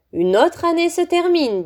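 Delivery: put-on old headmaster opening the feast; joyful. A narrator's reading. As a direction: greet the hall with delight. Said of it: "dit joyeusement Dumbledore".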